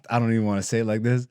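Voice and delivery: ordinary delivery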